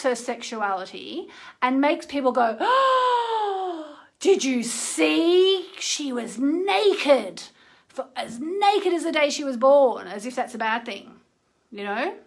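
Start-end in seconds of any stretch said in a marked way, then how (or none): 2.6-10.1 high, slow, shocked gossip voice; mock scandalized gossip. People gossiping about Kim Kardashian, high slow mock shock. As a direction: drag it out like a clutching-pearls busybody.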